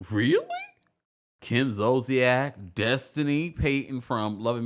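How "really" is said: much higher mock "Really?"; mock disbelief. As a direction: pop it up in fake shock. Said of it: "I'm like".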